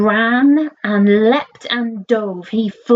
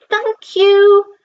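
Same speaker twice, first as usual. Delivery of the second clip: much higher, louder, excited thanks; breathless thanks; 11 semitones higher, 3 dB louder than usual. Acted. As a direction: call up thanks after the thrilling flight. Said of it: "Breathless, Frank waved".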